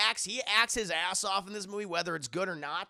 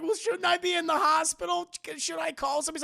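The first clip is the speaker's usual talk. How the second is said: high, loud pleading; imitating a distressed patient; anxious victim; 9 semitones higher, 3 dB louder than usual. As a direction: ask in a panicked, raised voice. Justin anxiously questioning his captor.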